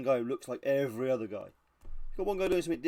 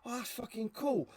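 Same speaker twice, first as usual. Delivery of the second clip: higher-pitched mock awe; excited reenactor; 9 semitones higher than usual. Another reenactor finds the photo, mock excited. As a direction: gush like an impressed copycat. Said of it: "another reenactor goes".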